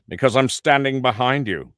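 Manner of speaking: a light Robin Leach line. Retold or imitated. Robin Leach catching the impressionist from behind him, lightly voiced. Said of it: "And I hear".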